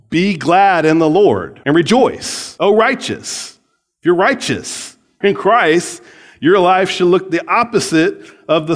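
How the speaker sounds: fervent preaching. Real, not acted. Preacher exhorting joy.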